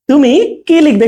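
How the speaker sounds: "তুমি", gap, long "কি লিখবে"; space before long কি. Shown as a demonstration. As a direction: lean on a separated "কি". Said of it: "একটু space দিয়ে কিটা". ("তুমি"-র পরে ফাঁক, দীর্ঘ "কি")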